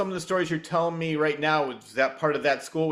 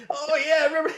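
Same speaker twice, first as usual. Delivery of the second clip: higher-pitched mock forgetful old man; mock old-timer; 12 semitones higher, faster than usual. Imitating forgetful future selves.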